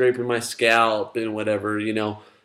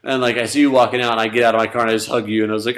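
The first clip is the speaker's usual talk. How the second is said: usual speaking voice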